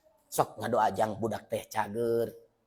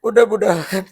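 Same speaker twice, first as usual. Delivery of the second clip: raised, higher, pleading voice; emotional plea; 8 semitones higher, 9 dB louder than usual. Real, not acted. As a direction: beg forgiveness for the late parents. Emotional prayer for late parents.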